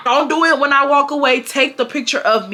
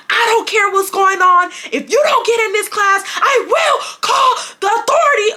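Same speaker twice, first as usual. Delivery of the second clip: mock teacher shout, much higher and louder; teacher yelling; 11 semitones higher, 4 dB louder than usual. Teacher yelling at a student by the door, mock shouted threat. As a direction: yell it like an angry teacher threatening. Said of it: "you wanna yell at me".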